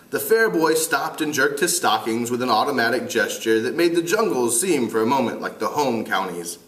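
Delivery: usual speaking voice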